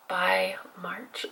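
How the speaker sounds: whispered aside. Real, not acted. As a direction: drop to a secret whisper. Aside about needing hair recolored.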